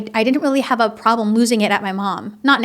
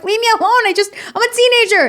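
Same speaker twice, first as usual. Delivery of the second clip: put-on exasperated teen; 11 semitones higher, 4 dB louder, faster than usual. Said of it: "and I'm like".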